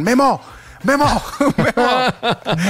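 fans yelling Memo. Retold or imitated. Fans shouting for a photo, high mock yell. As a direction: shout to get his attention. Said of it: "me gritan".

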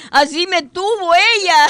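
high, loud, through real laughter; laughing. Real, not acted. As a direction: exclaim it while cracking up. Habla entre carcajadas. (exclamado entre risas, agudo y fuerte)

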